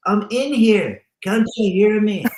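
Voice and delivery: lightly voiced trapped man calling; dead man calling out. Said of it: "he goes".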